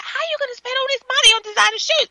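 high, fast mock scolding; retold mad mother. Retelling a mother's shoe rant, mock mad. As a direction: imitate an outraged mother without her accent. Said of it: "her mother was going mad".

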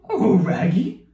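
high, loud cartoon dog; Scooby-Doo. Player demonstrates an easy clue, cartoon dog voice. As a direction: talk like a goofy cartoon dog. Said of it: "Scooby-Doo".

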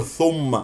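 ثم with a thick, dark vowel; wrongly heavy ثم. Shown as a demonstration. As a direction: darken ثم as a mistake. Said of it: "لا تفخم ثم". (ثم مفخمة خطأً)

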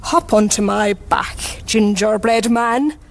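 lightly voiced coaxing fox; smiling fox. A narrator's reading. Fox luring the gingerbread man onto his back, smiling coax. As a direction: invite him with a sly smile. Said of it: "he said, smiling".

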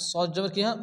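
usual speaking voice

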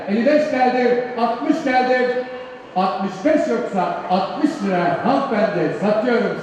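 rapid bid calling, pushing the price; auctioneer. Real, not acted. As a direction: call out bids like an auctioneer. (mezat çığırtkanı gibi teklif sayma)

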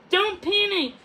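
high, loud urging calm; urgent insisting. A narrator's reading. Puffin urging Odette not to panic, urgent. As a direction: press it hard though scared. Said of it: "Puffin insisted".

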